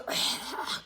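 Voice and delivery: voiceless, airy gasp after a strong drink; burning-throat exhale. Retold or imitated. Drinker just downed the whole flask, burning-throat exhale. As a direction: act out a throat on fire with a breath.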